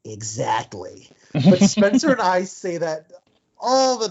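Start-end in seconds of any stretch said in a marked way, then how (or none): 0.0-1.1 low, slow, deadpan exactly; a hint of Andy Serkis, slow and low; quoting Serkis. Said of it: "Andy Serkis just goes".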